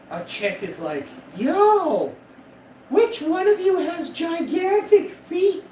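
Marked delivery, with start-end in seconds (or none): 1.3-5.6 high, slow, drawn-out mock girl calling out; girl's voice. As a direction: put on a high girly voice and stretch it.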